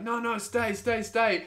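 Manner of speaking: high, urgent imitated pleading; mock freak-out. Bar staff plead with customers getting up to leave, mock panic. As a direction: mimic bar staff begging people to stay. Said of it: "everyone freaks out".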